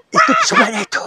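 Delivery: high loud pointing cry; excited shout. Real, not acted. Host shouts to his partner pointing at the spirit. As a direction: shout to make them look.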